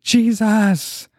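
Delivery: high crying call of the name; shouting his name. Retold or imitated. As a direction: call out Jesus desperately. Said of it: "immediately I shouted his name".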